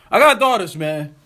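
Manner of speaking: mock him by repeating it; mocking quote. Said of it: "mocking the artist again".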